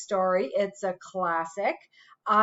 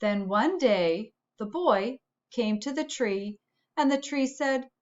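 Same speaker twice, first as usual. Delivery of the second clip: put-on storytelling for kids. A narrator's reading. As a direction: read it like bedtime story time for the class. Reads a picture book aloud.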